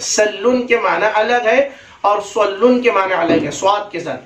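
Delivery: ordinary talk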